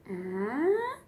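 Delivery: ah sliding upward; rising ah. Shown as a demonstration. Therapist showing parents a rising sound, pitch going up. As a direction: slide the ah up. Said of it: "make it go up".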